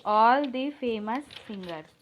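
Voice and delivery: every word spaced out slowly; slow dictation. Shown as a demonstration. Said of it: "जैसे इसका sentence ऐसा नहीं बनता कभी". (धीरे धीरे बोलकर)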